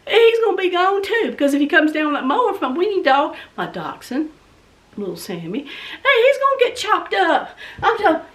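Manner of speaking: ordinary talk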